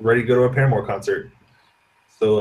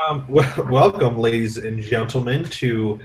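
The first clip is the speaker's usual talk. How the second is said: ordinary talk, nothing marked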